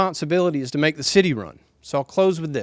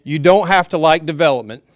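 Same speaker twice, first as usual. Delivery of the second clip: loud, raised voice to the room; raised voice, almost shouting; yelling; 2 semitones higher, 8 dB louder than usual. Real, not acted. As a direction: project it like a yell. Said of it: "when I'm yelling".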